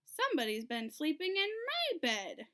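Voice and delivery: a mild grumble in a medium bear voice; mama bear grumbling at her squashed pillow. A narrator's reading. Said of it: "she grumbled".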